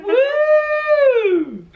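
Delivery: high, loud cheer; excited whoop. Real, not acted. Cheering to lighten mood.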